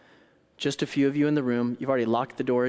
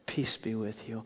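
softer, calm and gentle; peaceful calm voice; 3 semitones lower, 8 dB quieter than usual. Acted. Jesus greeting locked-in disciples, peaceful and soft. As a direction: soothe the room quietly. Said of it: "his peaceful, calm voice".

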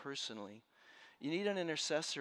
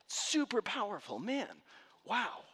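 excited; 8 semitones higher, 5 dB louder, slower than usual. Real, not acted. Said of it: "exclaims about intercessors".

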